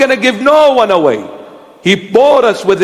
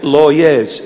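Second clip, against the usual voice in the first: short, lower Lebanese-accented word; 7 semitones lower than usual. Shown as a demonstration. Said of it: "That was a Lebanese accent".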